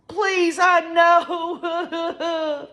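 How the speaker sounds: high, drawn-out wail breaking up at the end; mock sobbing plea; imitated weeping. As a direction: act out a mother's tearful plea. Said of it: "emotionally weeping".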